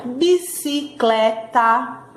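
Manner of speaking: bi-ci-cle-ta, slow and separated; syllable by syllable. Shown as a demonstration. Teacher sounds out Bicicleta for pupils, slowly by syllables. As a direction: tap out each beat of Bicicleta. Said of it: "a partir de quatro sílabas".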